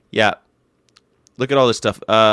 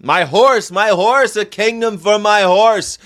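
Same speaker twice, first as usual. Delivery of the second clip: high, loud, theatrical Shakespearean plea; dramatic dying king; 9 semitones higher, 5 dB louder, slower than usual. Retold or imitated. Richard the Third begging for a horse, hammed-up and loud. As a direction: cry out for a horse like a king losing the battle. Dying king's famous cry.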